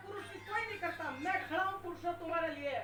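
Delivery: normal talking voice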